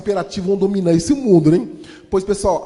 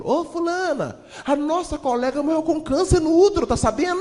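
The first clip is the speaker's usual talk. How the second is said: high calling voice; gossiping cleaner; 9 semitones higher than usual. Retold or imitated.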